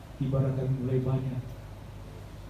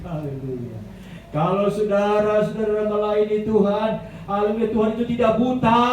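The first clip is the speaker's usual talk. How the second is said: full-force preaching; 8 semitones higher, 5 dB louder than usual. Acted. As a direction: proclaim it to the whole church.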